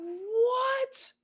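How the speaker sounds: very high, strained, almost crying what; stunned. Retold or imitated. Hearing people say it works, a stunned high what. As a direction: squeak it out in total shock. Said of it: "I'm like".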